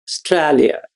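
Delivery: light imitation Australian accent on "Australia". Shown as a demonstration. Teacher shows students the changed word, in a light Australian accent. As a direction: say "Australia" with an attempted Aussie vowel, "Aus-TRAH-lia". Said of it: "now change that accent".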